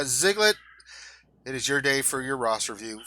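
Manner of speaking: ordinary talk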